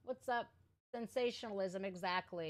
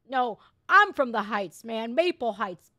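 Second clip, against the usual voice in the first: indignant shout; 8 dB louder than usual. Real, not acted. Ranting rebuttal to celebrity.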